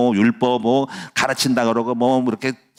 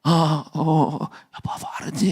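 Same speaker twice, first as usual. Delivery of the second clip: halting, weak mock nagging with little voice; imitated voiceless nagging; 3 semitones higher, 3 dB quieter, much slower than usual. Preacher mimics his sore-throated wife, weak strained nagging. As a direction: strain to nag through a failing voice. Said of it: "잔소리를 막 하는데 요즘 이렇게".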